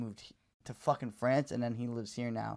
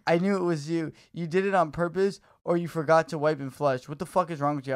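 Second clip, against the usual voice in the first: loud furious rant, pitched higher; angry texter; 3 semitones higher, 7 dB louder than usual. Retold or imitated. Stranger texting about shit in his toilet, loud angry rant. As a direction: tear into him like a disgusted stranger. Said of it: "And then he's like".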